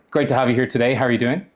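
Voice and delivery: ordinary talk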